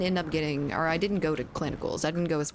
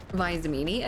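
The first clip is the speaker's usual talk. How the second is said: ordinary delivery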